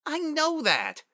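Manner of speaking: put-on very high childish whine; whining. A narrator's reading. Young Spitfire to her consoling dad, whining. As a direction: whine it like a sulky kid. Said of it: "Spitfire whined".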